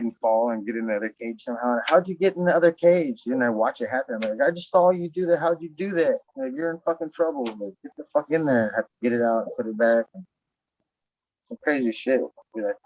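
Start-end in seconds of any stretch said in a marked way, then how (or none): 4.5-8.7 higher, exasperated telling-off; mock scolding. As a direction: scold the stuck horse in a raised pitch. Scolding the horse stuck upside down.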